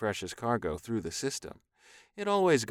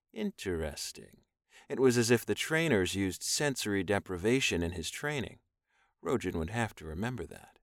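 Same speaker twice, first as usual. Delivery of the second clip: ordinary delivery, nothing marked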